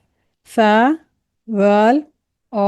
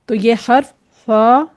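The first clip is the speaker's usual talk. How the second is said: ordinary talk, nothing marked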